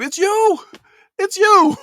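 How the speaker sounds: very high, loud mock yell of "It's you"; [name] shout. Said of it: "I feel like [name]".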